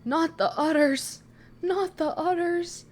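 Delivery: high, loud alarmed cry of not the udders; panicked yell. Real, not acted. Streamer to chat watching the creature, panicked yelling. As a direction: yelp it out in real panic.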